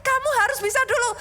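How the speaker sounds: put-on high-pitched mom putting the child off; insisting mother. Mother tells child to learn it first, high-pitched insisting. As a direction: play a mother holding firm, voice pitched well up. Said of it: "Nah, mamanya bilang".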